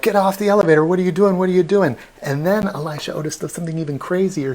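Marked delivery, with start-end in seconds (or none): 0.0-2.0 panicked crowd yelling. Retold or imitated. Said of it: "they're like".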